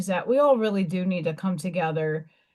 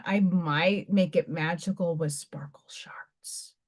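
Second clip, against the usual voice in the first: soft unvoiced whisper; 5 semitones lower, 5 dB quieter than usual. Real, not acted. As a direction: whisper it under your breath. Whispered aside to herself.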